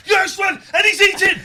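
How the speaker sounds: high, loud laughing shout; excited shout. Real, not acted. Catching guest eating on camera.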